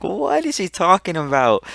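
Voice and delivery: high-pitched incredulous question; mock disbelief, voice jumping up; retold bafflement. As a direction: act out total confusion at the teacher. Student baffled by teacher's lecture.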